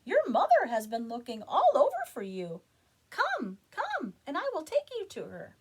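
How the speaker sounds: high, loud eager bird voice; bird urging. Acted. Bird urges chicken home to its mother. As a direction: hurry the chick along, bright and loud. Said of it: "it exclaimed".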